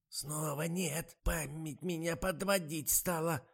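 высокий, медленный жалобный старушечий голос; сетует на память; жалоба старухи. A narrator's reading. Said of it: "пожаловалась Ада Марковна". (жалобный высокий старушечий голос)

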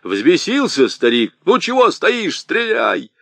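loud, high urgent yell; shouting. Acted. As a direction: shout at him to shoot now. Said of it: "Сарбалахов закричал Харлампию". (Сарбалахов кричит Харлампию: стреляй, громко и резко)

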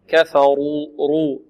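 normal talking voice

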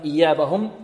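ordinary talk, nothing marked

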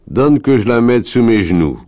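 high, loud rustic voice; demanding wolf leader. Acted. Wolf leader wants the child handed to him, demanding.

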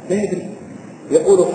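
ordinary talk

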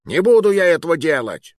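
high, loud protest; indignant refusal. A narrator's reading. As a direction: dig in and refuse, affronted. Fat man refuses order to shoot. (возмущённый отказ)

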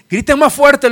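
a high, loud taunting yell; mocking shout. Elijah mocking the Baal prophets, loud jeering shout. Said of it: "Elías está gritándoles de ahí".

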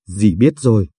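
a hint of a choked aunt's voice; lightly voiced lump in the throat; understated choked reply. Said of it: "Giọng dì Lải nghèn nghẹn".

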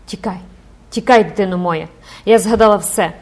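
loud, urgent cry to flee; mother screaming at daughter to flee; desperate cry. Acted. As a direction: urge your child to run, panicking. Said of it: "розпачливо скрикнула".

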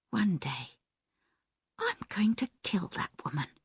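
breathless, fast, hushed whisper; quiet whisper. Acted. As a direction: hiss the threat under your breath. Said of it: "Diane said quietly".

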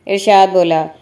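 plain reading voice

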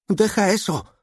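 high-pitched cry of protest; shrieked demand from the daughter; daughter's shout. A narrator's reading. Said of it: "gritó ella".

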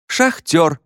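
high-pitched call from the class; shouted guess. A narrator's reading. Pupil guessing Уилл's mime, excited shout. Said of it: "закричал кто-то".

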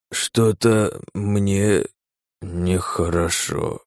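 slow, halting, fading speech; words break apart, dying away; broken, strange voice. Acted. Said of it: "как-то ломано странно проговорил". (ломаный странный голос)

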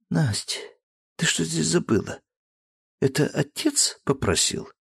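lightly acted wonder; surprised daughter. Daughter greeting her friend at the door.

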